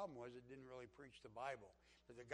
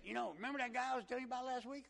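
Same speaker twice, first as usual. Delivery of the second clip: high, loud, fast country voice; country accent; 10 semitones higher, 8 dB louder, faster than usual. Retold or imitated. Country man updating his class, quick country accent. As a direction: imitate an eager country fellow. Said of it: "he just talked like this".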